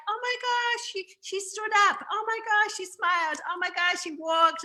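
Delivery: put-on high-pitched gushing over a little kid; acted parental delight. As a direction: marvel like a doting parent, voice way up high. Parents cheering a toddler's milestones.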